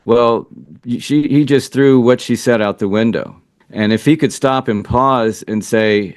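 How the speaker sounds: ordinary delivery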